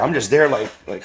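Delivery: ordinary talk, nothing marked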